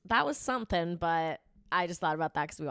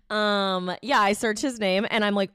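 ordinary talk, nothing marked

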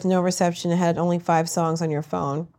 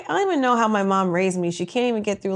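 ordinary talk, nothing marked

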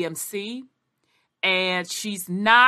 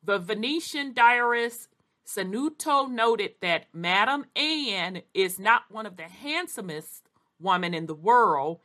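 ordinary talk, nothing marked

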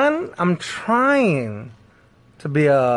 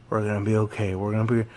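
reassuring; 7 semitones lower, 6 dB quieter, faster than usual. Retold or imitated. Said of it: "She grabs my hand and she goes".

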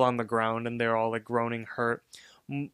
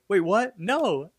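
high, startled mock protest; Margaret; 8 semitones higher, slower than usual. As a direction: imitate a girl taken aback, pitched up. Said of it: "And then Margaret's like".

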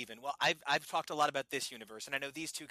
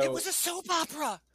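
excited outburst; 6 dB louder than usual. Real, not acted. Playful heated interjection.

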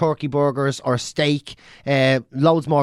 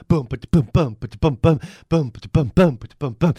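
imitating drum bands. Mocking hippie drum circles heard across town, mouth drumming. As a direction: mouth drumming boom ba-da-bum. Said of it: "So they can learn one".

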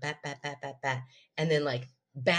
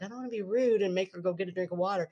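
sleepy husband voice; 4 semitones higher, faster than usual. Retold or imitated. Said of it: "you're like".

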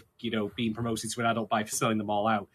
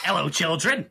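higher, put-on cartoonish greeting; Zybox impression; 4 semitones higher, 4 dB louder than usual. Host greeting the orphans in character, playful put-on voice. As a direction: greet them in a silly character voice. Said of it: "It's a Zybox".

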